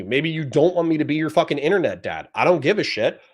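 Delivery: normal talking voice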